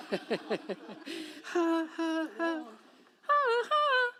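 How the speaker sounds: laughs at own joke, climbing high at the end; a real laugh breaking up into ha-ha's; real laughter. Real, not acted. Laughing after wilderness joke.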